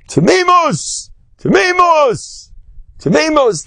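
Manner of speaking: mock sermon cry, each word stretched; fiery preacher cry. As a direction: call it out like a rabbi setting the hall on fire. Imitating a rabbi's fiery sermon.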